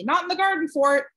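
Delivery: calling the dog out of the garden. Acted. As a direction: call the dog off, loud and sharp. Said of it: "Meg called automatically".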